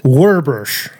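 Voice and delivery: "Werbush", both syllables rolled with r; "Werbush" said with r-colored vowels. Shown as a demonstration. Host trying out the town name the local way, Werbush. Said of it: "That's it".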